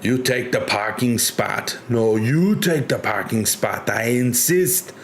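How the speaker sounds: put-on Arnold-style Austrian accent, insisting; Arnie accent. Streamer imitates Arnold insisting over a parking spot, Arnie accent. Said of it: "some Arnie accent".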